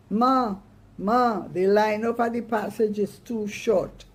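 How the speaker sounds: put-on boy shouting down from upstairs; calling out. Calvin calls down to Mama from upstairs, shouting. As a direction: call out to Mama across the house.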